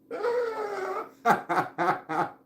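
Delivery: squealing burst of laughter; high laugh. Real, not acted. Laughing at own story.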